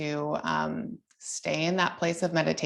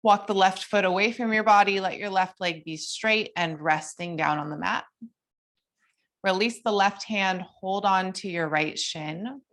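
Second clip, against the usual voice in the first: higher, gentle guiding cues; yoga instructor voice; 4 semitones higher than usual. Acted. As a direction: lead the class through poses calmly.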